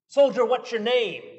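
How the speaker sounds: loud, commanding demand. A narrator's reading. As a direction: bark the question like a general. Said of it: "demanded Alexander the Great".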